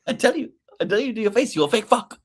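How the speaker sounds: put-on high, fast accented rant through laughter; laughing Lombard mimicry; Hector Lombard impression. Host repeats the Lombard impression while laughing. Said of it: "Perfect Hector Lombard impression".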